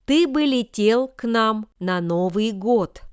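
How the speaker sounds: ordinary talk, nothing marked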